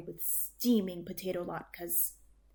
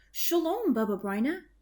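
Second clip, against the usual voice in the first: cheerful greeting; 7 semitones higher, 5 dB louder than usual. A narrator's reading. Friends greeting at door.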